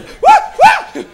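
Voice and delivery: very high, very loud startled what; startled shout. Retold or imitated. Stoned driver startled, shouting very high and loud. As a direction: jump in alarm.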